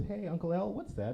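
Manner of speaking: hint of a curious kid; young niece. Niece asking her uncle at the mall, lightly childlike and high. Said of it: "and she goes".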